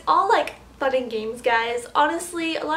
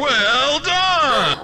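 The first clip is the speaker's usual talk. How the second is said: mock cheer; 9 dB louder than usual.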